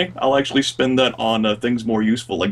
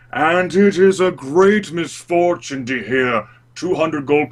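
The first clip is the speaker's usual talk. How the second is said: higher-pitched, indignant war veteran speech; proud veteran haggling; 5 semitones higher than usual. Acted. Veteran warrior haggles over horses with the trader, proud and indignant. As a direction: grandly recount your service, then refuse to pay. Player voices Drolin haggling.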